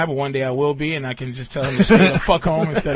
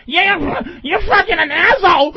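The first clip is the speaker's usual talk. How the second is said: high, loud mock yell; mock emotional woman; 11 semitones higher, 6 dB louder than usual. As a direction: ham up a shrill emotional woman. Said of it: "It's not like".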